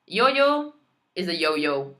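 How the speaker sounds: ordinary delivery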